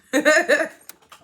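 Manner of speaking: high, loud shriek of laughter; a sudden loud laughing squeal; loud burst of laughter. Real, not acted. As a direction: crack up out loud. Reacts to the insult "old bitch.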